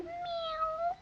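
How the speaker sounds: very high, soft cat mew; high meow. Acted. Girl's kitten mews from hiding.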